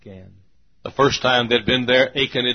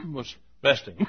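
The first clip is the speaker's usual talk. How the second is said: ordinary talk, nothing marked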